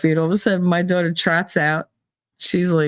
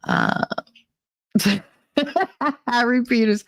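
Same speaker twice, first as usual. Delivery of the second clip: ordinary talk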